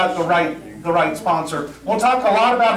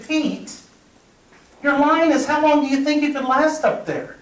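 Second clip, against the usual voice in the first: much higher, spelling it out; exasperated explaining; 8 semitones higher than usual. Retold or imitated. To a castmate who missed his cue, a higher exasperated explanation.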